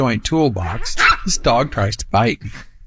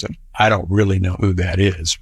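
ordinary talk, nothing marked

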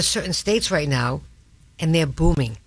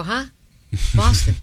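a louder, puzzled "Boston?" as if she never heard of it; puzzled outsider; 3 semitones higher, 4 dB louder than usual. Retold or imitated. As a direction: echo Boston back in mock confusion. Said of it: "They go".

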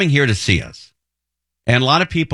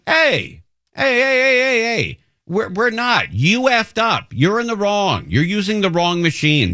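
heated scolding; 7 semitones higher, slower than usual. Retold or imitated. Host scolding tourist.

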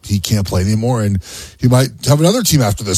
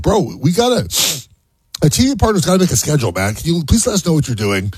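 mild mock begging, pitched higher; Adam Silver; 6 semitones higher than usual. League boss begging a star to decide, mildly pleading. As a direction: lightly imitate an exasperated plea. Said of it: "Adam Silver's begging him".

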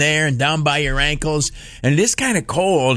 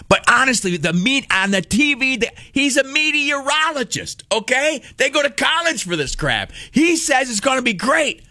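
pitched way up, insisting on the meteorologist; indignant defense; 10 semitones higher than usual. Real, not acted. Dad defending the TV weatherman, pitched high and indignant.

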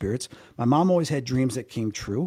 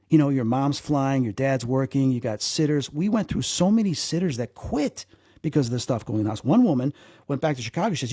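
usual speaking voice

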